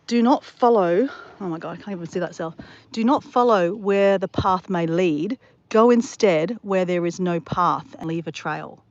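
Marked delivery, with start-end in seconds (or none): 0.1-1.1 slow, loud, deliberate reading; loud reading aloud. Real, not acted. Reads a motto aloud. 2.9-8.8 slow, loud, deliberate reading; loud, measured inspirational quote; declaimed motto reading. Real, not acted. Reads a motto aloud.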